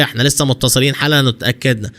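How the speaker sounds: plain reading voice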